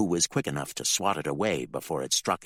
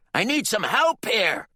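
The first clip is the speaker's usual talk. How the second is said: much higher, louder call for help; yelled; 6 dB louder than usual. Acted. Bill yells to his congregation for help. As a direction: yell across the church. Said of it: "he yelled to his little congregation".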